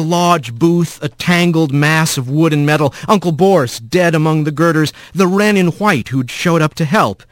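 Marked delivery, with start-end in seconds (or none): none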